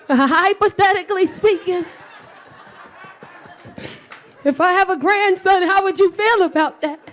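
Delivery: high, careful hypothetical; tentative. Acted. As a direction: feel out a boyfriend nervously. Nervously breaking news to boyfriend.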